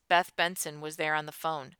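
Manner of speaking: ordinary delivery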